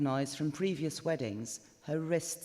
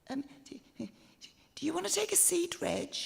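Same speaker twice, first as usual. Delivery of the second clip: put-on much higher, polite young woman; Ashley the bridesmaid; 13 semitones higher than usual. The bridesmaid politely interrupts Reg, higher and sweet. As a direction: cut in sweetly to stop him. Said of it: "Let's hear what they're saying".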